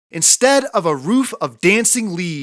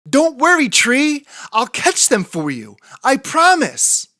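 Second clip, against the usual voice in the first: anxious promise; 7 semitones higher than usual. A narrator's reading. Fletcher anxious in strong wind.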